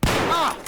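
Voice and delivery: high-pitched exclaimed ah; high cry. Acted. Player reacting to the clip, high yelp.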